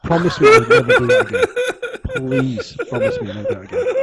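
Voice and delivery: laughing. Real, not acted. Laughing hard at friend's singing.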